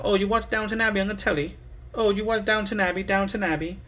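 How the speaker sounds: high, prissy mock British accent, fast; mock English accent. Mocking the English over Downton Abbey, in a high mock British accent. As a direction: ham up a posh English accent. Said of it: "You know".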